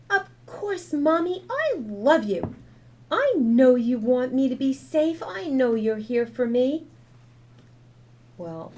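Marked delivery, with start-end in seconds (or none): 0.1-6.9 put-on high, loud child's voice full of love; loving little girl. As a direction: reassure Mommy she's forgiven. Child forgiving her mother.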